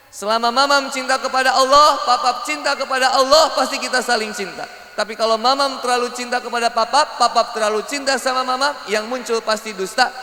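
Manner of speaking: high, insistent sermon voice; impassioned preaching. Acted. Preacher warning couples about misplaced love, impassioned. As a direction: press each clause on the listeners.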